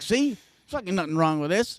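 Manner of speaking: high, gloating triumph; triumphant. Retold or imitated. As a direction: crow it out proudly. Triumphant after smoking.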